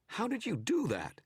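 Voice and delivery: high, sharp snarl of a rattled foe; stung image snarls at the wizard. Acted. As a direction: snap it back, caught off guard. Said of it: "it snarled".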